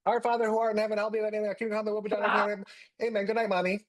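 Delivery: put-on child saying prayers. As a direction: recite it like a little boy speaking at last. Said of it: "He goes".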